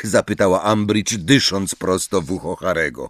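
plain reading voice

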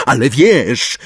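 loud, high insistence; shouted retort. A narrator's reading. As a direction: throw it back at the elf loudly. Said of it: "krzyknął Harry". (głośne, natarczywe krzyknięcie)

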